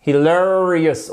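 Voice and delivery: "Hilarious" slowly, LA drawn out, hi-LAAA-rious; "Hilarious" with a long, heavy second syllable; stress on second syllable. Shown as a demonstration. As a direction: stretch the LA of "Hilarious". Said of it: "on a bien notre accent tonique ici".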